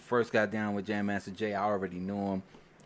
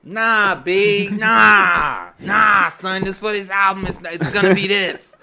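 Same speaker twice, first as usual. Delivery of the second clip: higher, louder retold pleading; mock heated protest; 7 semitones higher, 10 dB louder, slower than usual. Producer arguing with fifty over the song, a loud mock protest. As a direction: push back hard but playfully.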